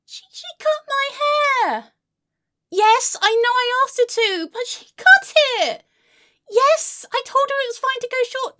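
high, wailing protest; whining outrage. Acted. Friend pointing out she asked for it, whining outrage. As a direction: insist shrilly over their objection.

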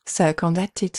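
ordinary talk, nothing marked

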